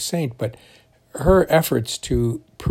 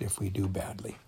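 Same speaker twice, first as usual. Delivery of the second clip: whisper; 4 semitones lower, 12 dB quieter than usual. Real, not acted. Whispered end of a sentence.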